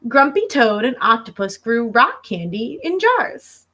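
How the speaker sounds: usual speaking voice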